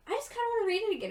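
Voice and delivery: rushed, high-pitched eager self-quote; her past self. Retold or imitated. Quoting herself deciding to reread, high and rushed. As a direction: blurt it out quickly in a high, eager voice.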